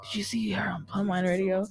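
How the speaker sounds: hushed excited gossip; gossipy whisper. Retold or imitated. Fans gossiping about the show, in a whisper. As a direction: whisper it like passing on hot news. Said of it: "Where people are like".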